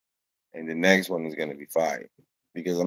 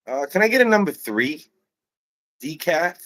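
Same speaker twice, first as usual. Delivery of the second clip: higher-pitched American customer; American accent; 8 semitones higher, 4 dB louder than usual. Retold or imitated. Customer ordering at a coffee shop, American accent. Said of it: "talking, you know, with an American accent".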